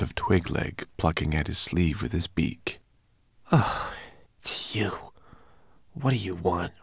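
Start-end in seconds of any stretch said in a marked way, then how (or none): 3.5-6.7 irritated hushed whisper; annoyed whisper. A narrator's reading. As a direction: hiss at the raven not to wake anyone. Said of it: "whispered the homunculus, annoyed".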